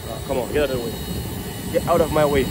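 ordinary talk, nothing marked

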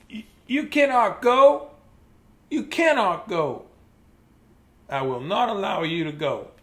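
rising, pleading elephant voice. Acted. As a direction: beg your friend not to leave. Gerald pleads with Piggy.

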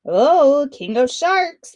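loud, slow call across the water; boy calling down to the shark king from the cliff. Acted. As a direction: stretch it out to reach the sea below. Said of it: "Punia called".

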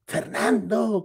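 mock call, very slow on the name; retold calling out. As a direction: imitate yelling after a friend in the street. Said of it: "un cuate que le gritaba".

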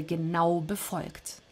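ordinary delivery

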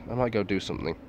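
ordinary talk

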